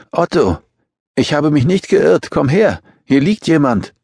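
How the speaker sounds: high-pitched woman calling out; urgent calling. A narrator's reading. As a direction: summon someone urgently, pitch well up. Woman calls Otto over urgently.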